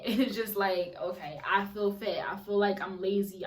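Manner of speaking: ordinary delivery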